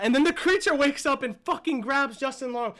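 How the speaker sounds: loud, high surge of storytelling; excited recap. Real, not acted. Fan retelling the creature waking and grabbing the villain, hyped up. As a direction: hit the twist with loud excitement. Recounting creature's sudden attack.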